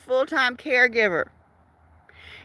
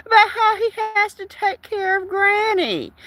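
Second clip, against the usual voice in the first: whiny mocking voice; 8 semitones higher than usual. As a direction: drag it out like a sulking child. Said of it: "he whines constantly".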